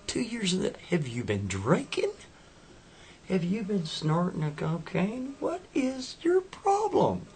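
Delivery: put-on high, indignant girlfriend. Acted girlfriend voice, arguing.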